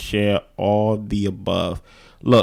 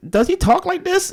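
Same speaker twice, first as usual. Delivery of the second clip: much higher-pitched retold question. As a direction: pitch the quoted question way up high. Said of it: "looked at Blair and I said".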